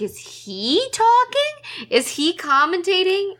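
high, slow, puzzled questions; baffled disbelief. Retold or imitated. Friend retelling her confusion at a movie scene, high and bewildered. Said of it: "and I was like".

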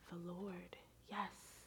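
barely audible murmur; very quiet. Retold or imitated. Somber tech quietly agreeing with the customer, murmured. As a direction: hush it like a private confession.

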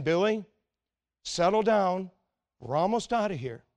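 usual speaking voice

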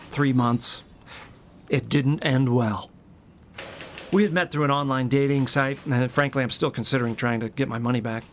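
usual speaking voice

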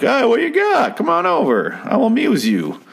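hammed-up cheery old-fashioned bar patron; friendly old-timer. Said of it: "Back then, they're like".

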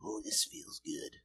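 very soft, voiceless whisper; mock whispered relish. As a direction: whisper it as if savoring a smoke. Acts out smoking plutonium rods.

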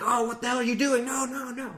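high, exasperated scolding gamer; a mock-serious complaint pitched well up; overly serious player. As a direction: scold a teammate who is goofing off.

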